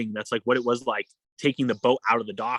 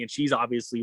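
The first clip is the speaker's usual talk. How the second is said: ordinary talk, nothing marked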